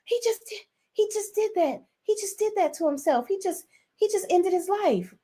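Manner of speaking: high, hushed mock panic; imitated freak-out. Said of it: "freaking out. She's so upset".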